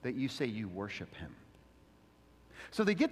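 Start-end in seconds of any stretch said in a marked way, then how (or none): 0.0-1.4 hushed question. Retold or imitated. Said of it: "They're asking the question".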